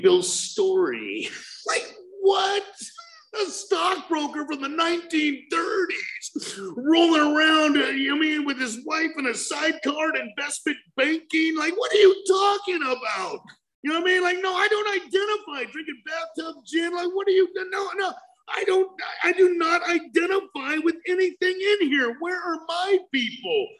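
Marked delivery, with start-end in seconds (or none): 2.2-22.2 incredulous outburst. Retold or imitated. Outraged reading of Bill's story. 22.2-23.7 impassioned demand. Real, not acted. A convict asking the book where his people are, impassioned.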